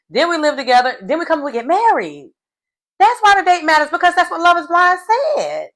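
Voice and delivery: high-pitched, exasperated lecture; indignant telling-off. Acted. Reminding the groom why they came to marry, indignant. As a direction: scold him as if he stood right there.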